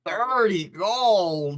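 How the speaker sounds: excited exclamation. Acted. Performer tempted away from the play, thrilled and drawling. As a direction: stretch both words out in awe. Player dazzled by the offered payment.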